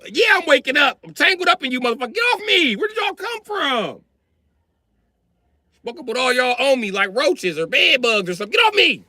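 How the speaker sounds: high exasperated yelling; annoyed yelling. Retold or imitated. Dad tangled up in his kids, high exasperated yelling. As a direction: shout it, fed up and tangled.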